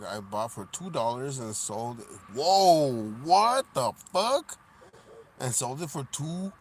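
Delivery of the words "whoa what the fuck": loud slow shocked exclamation. Real, not acted. Streamer reacts to a bag selling high, loud and surprised. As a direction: blurt it out in disbelief.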